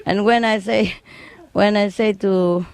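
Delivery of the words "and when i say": ordinary talk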